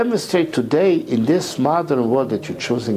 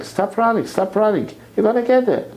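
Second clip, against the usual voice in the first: imitating a yell; 9 semitones higher than usual. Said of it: "to start yelling".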